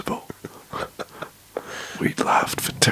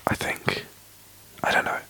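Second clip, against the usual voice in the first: hushed podcast whisper, breathy and close. Real, not acted. Whispers all through podcast.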